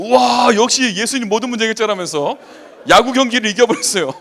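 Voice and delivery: loud, high-pitched exclamation of amazement; excited mock acclaim, full voice; awed cheering. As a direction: cry out like a thrilled believer praising a win. Acting out cheering fans after a win.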